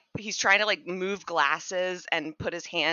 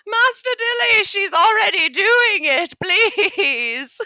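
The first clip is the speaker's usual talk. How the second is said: much higher and louder, begging; squeaky servant in full panic; frantic pleading; 13 semitones higher, 10 dB louder, slower than usual. Acted. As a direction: cry out to his master for rescue. Anton begs master for help.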